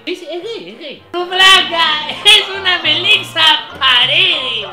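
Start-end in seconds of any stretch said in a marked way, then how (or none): 1.1-4.7 slow, loud, higher yell; shouted punchline. Acted. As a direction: bellow the running joke, stretched out. (grito lento y fuerte del remate)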